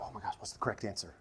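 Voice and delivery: quick, under-the-breath fluster; hushed mock fretting. Preacher imitating someone asked who Jesus is, hushed mock worry. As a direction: play anxious thinking aloud, very soft and hurried. Acting out a person anxiously thinking.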